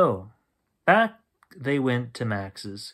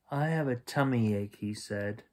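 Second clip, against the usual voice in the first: quiet, lower, miserable voice; soft sick plea; 4 semitones lower, 7 dB quieter than usual. A narrator's reading. Max standing by the bed with a tummy ache, soft and low. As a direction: whimper it out.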